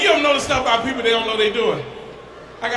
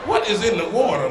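usual speaking voice

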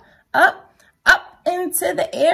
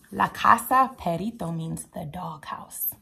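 whispered aside; 5 semitones lower, 6 dB quieter than usual. Acted. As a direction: lean in and whisper the meaning. Whispered aside explaining word.

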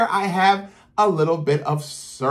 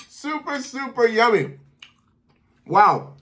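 mouth full; 7 semitones higher than usual. Real, not acted. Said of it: "I'm starting to talk with food in my mouth".

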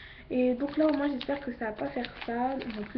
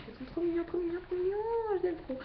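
cutesy gushing; 8 semitones higher, faster than usual. Real, not acted. Gushing over cute panda slippers.

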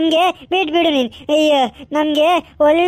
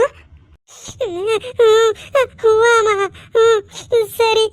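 sobbing; 3 semitones higher, much slower than usual. Acted. Daughter sobbing.

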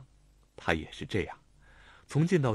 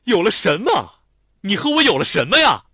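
glaring demand; 10 semitones higher, 12 dB louder than usual. A narrator's reading. Xiao Tong demands an answer from Lanlan, furious. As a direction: hurl the question, outraged. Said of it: "瞪着眼问".

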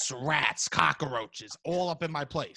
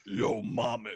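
lower, gruffer "Yo, mommy" growled low; tough mommy; 7 semitones lower, slower than usual. Shown as a demonstration. Second try for Robbie, a lower tough-guy "Yo, mommy". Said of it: "Let me try".

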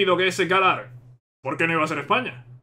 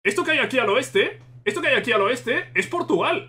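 ordinary talk

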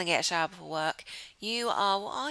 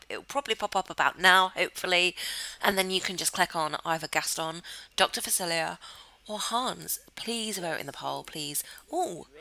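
ordinary talk, nothing marked

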